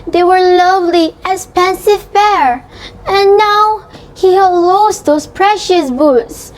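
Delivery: sing-song storybook lilt; lilting, overdone read-aloud tune; exaggerated cartoon intonation. A narrator's reading.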